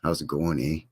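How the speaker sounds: lightly played Canadian accent, low and soft; tossing off a Canadian "eh" greeting. Shown as a demonstration. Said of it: "as we say in Canadian land".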